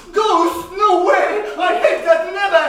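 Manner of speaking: high, loud frightened squeal; little boy panicking about a ghost, loud and high; scared kid. Acted. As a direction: shriek in childish fright.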